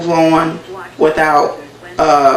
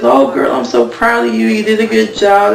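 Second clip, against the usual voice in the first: lightly voiced, higher gushing praise; a touch of a proud girlfriend cooing; gushing friend; 6 semitones higher than usual. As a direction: gush over her like a proud friend. Friend praising her dress.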